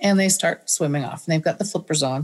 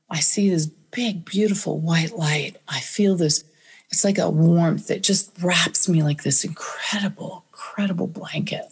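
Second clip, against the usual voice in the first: awed whisper. Retold or imitated. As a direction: whisper it like a sacred memory.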